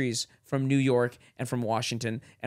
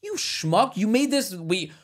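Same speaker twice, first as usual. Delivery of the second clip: mock outrage; 7 semitones higher than usual. Ranting at founding fathers.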